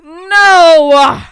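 loud shocked no; shocked shout. Real, not acted. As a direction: cry out in dismay. Shocked reaction to video.

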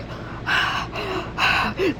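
long airy swooning sigh; a lovestruck exhale; dreamy sigh. Pretend. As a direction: sigh like she's been left breathless. Narrating Anna left breathless.

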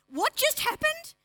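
a hammed-up gasp of shock; shocked high. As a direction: squeak out disbelief slowly. Haman stunned by bombshell.

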